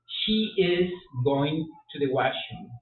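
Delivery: slow full form. Shown as a demonstration. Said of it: "La contracción del verbo to be".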